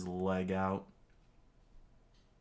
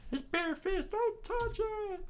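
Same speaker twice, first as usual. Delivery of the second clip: high panicked cry. A narrator's reading. Crewmate warning Luffy off the spikes, very high squeal.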